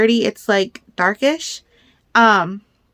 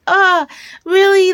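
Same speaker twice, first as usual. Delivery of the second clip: high and loud, thrilled disbelief; excited surprise; 9 semitones higher, 4 dB louder, slower than usual. Retold or imitated. Hearing the yarn lady has owls, a high thrilled gasp. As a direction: jump up in pitch, amazed. Reacting to yarn lady's owl.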